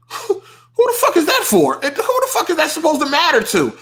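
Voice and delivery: incredulous laugh, high pitched; laughing while ranting; laughing exasperation. Real, not acted.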